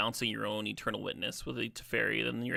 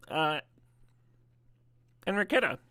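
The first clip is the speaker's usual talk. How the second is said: talking with mouth full; 5 semitones higher, 4 dB louder than usual. Real, not acted. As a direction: speak around a mouthful of food. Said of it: "I'm talking with my mouth full".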